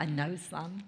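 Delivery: low, soft fatherly reply; a hint of a quietly knowing dad; lightly voiced father. Preacher voices God answering his Son, low and soft. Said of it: "He's like".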